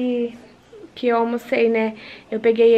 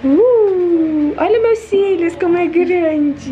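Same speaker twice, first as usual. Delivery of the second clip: higher, louder cooing, stretched slowly; 4 semitones higher, 4 dB louder, much slower than usual. Real, not acted. Mother admiring her baby's lashes, in high cooing baby talk.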